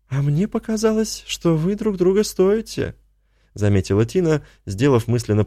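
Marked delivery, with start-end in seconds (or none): 0.1-2.9 put-on higher young woman. A narrator's reading. Tina, high voice.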